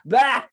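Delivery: loud punchline sting; rimshot noise. Acted. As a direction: bark a loud "bah". Rimshot after the joke.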